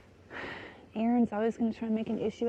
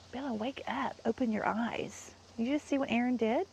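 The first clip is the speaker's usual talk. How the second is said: ordinary delivery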